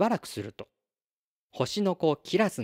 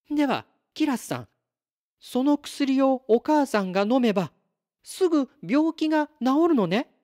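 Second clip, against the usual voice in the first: 高く張った声で勢いこんで確かめる; 母が治る望みに飛びつく娘として; 期待に弾む女の子; 10 semitones higher, 5 dB louder than usual. Acted. 母が治ると知った娘の台詞. (高い声で必死に念を押す女の子)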